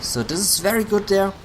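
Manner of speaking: fast, high excited call. Real, not acted. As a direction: rattle it off in excitement. Caster excited at a survival.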